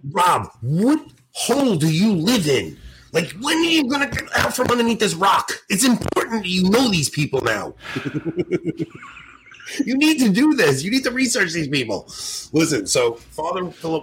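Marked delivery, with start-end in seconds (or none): 0.1-12.1 high-pitched, exasperated mock rant; mock scolding. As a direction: scold a clueless friend in playful disbelief. Mock scolding of uninformed co-host.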